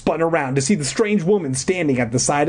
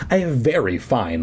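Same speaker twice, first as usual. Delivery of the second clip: plain reading voice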